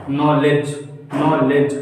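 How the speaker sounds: नॉलेज said as "nolej", no d sound before the j; teacher drills नॉलेज slowly with the d left out; silent d demo. Shown as a demonstration. Teacher showing students नॉलेज without the d. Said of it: "डी नहीं उच्चारित होता".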